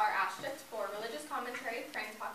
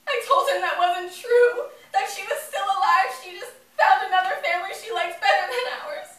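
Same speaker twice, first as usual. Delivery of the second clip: loud, high, tearful insistence; anguished denial; 9 semitones higher, 16 dB louder than usual. Acted. Child telling dad that mother is still alive, anguished shouting. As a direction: cry it out, refusing to believe.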